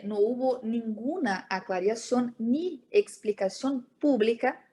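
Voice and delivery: ordinary delivery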